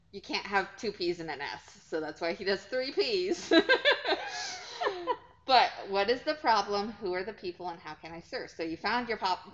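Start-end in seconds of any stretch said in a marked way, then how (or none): 3.6-5.2 high laugh. Real, not acted. Laughs at own joke.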